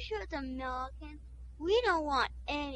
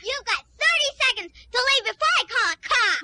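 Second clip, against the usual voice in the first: screaming ultimatum, much higher and louder; furious threat; 9 semitones higher, 10 dB louder than usual. Acted. The hostess screams at the guests to leave. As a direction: throw them out at full voice.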